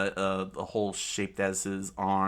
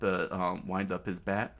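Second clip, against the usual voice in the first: ordinary delivery, nothing marked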